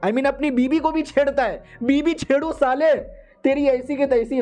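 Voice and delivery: high-pitched, hammed-up scolding; mock-furious mother-in-law; angry tirade. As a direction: rage at the culprit, pitch kept high.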